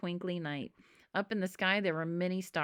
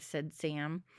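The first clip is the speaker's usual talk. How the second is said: ordinary delivery, nothing marked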